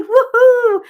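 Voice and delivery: high, loud whoop of joy; excited cheer. Real, not acted. Host whooping for a viewer's recovery, excited cheer. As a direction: cheer her on with a big whoop. Cheering Rose's good news.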